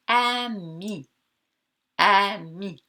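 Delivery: "Ami" in two separate beats, a-mi; split into syllables. Shown as a demonstration. Teacher counting voice beats for pupils, "Ami" split a-mi. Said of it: "Combien de coups de voix".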